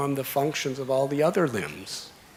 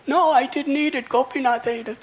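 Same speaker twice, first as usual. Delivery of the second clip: put-on crying child; 12 semitones higher, 5 dB louder than usual. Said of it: "so he started to cry".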